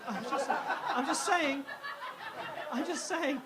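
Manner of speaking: giggling through a defensive repeat; chuckling I'm just saying; laughing. Real, not acted. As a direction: laugh it out, half apologising.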